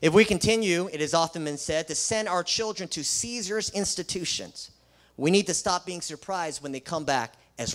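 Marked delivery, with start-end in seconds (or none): none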